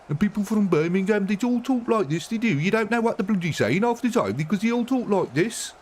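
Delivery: high-pitched, mocking Birmingham accent. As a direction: squeak it out in a Brummie accent. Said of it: "That's a Birmingham accent in the U K".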